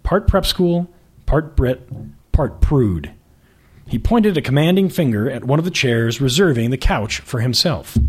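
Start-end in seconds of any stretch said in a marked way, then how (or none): none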